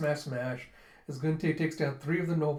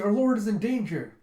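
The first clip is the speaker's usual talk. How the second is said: alarmed cry; 5 semitones higher, 6 dB louder than usual. Retold or imitated. Followers crying alarm.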